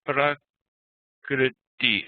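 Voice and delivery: "Prakriti" slowly, syllable by syllable; careful slow demo of "Prakriti"; correct Sanskrit pronunciation. Shown as a demonstration. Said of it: "It should be".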